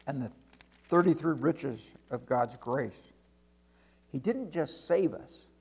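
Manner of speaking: ordinary delivery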